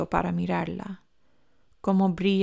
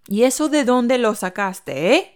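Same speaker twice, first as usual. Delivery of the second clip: triumphant; 5 semitones higher, 7 dB louder than usual. Acted. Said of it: "Esti sonríe triunfal".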